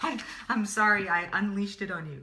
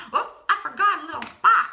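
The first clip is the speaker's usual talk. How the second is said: laughing; 8 semitones higher, 6 dB louder, faster than usual. Real, not acted. Laughing at her missed spot.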